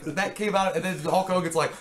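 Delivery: usual speaking voice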